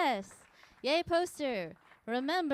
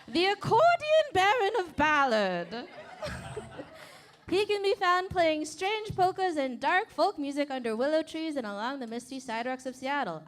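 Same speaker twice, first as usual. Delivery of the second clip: higher, louder hammed-up announcer voice; 4 semitones higher, 4 dB louder than usual. Host introducing the accordion player, in a big hammed-up voice. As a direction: oversell the intro like a grand showman. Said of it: "okay, no, I'm gonna do the voice".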